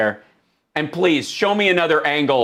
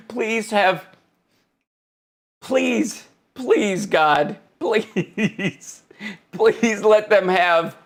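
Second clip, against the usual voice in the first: pleading through real laughter; beg, breaking off into laughs; dying laughing; 3 semitones higher, much slower than usual. Real, not acted. Streamer begging for debate mics, dying laughing. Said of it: "I'm dying over here".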